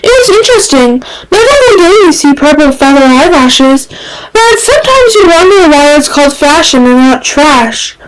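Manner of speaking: put-on high, quick, chatty young man; a high, gossipy Reed voice; chattering Reed. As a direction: rattle on breezily as Reed. Reed chattering about fashion.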